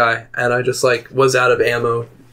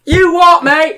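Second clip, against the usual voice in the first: very high, louder mock Irish yell; screaming mock Irish; 6 dB louder, slower than usual. Gamer yelling at other players, screaming mock Irish. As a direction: holler it in a hammed-up Irish accent. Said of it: "screaming at people acting like an Irish person".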